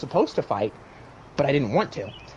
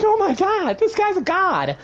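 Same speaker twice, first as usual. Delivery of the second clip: high-pitched awestruck cry; acted awe; slower than usual. Dreamer facing a giant opponent, high awestruck voice. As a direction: gasp it out in mock amazement.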